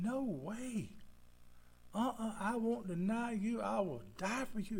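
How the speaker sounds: a lightly voiced eager disciple; eager Peter.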